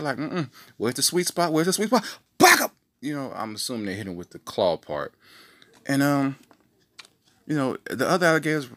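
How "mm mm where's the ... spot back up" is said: high, hammed-up hunter, building to a loud shout; playing the croc jumper, rising to a yell; mock crocodile wrestler. Man sizing up a crocodile, then yelling back up.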